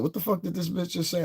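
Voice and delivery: high incredulous squeal; mock the friend's disbelief, way up high; a high squeaky retold friend in disbelief; shocked friend.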